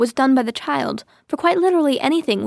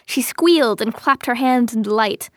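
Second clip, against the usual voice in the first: plain reading voice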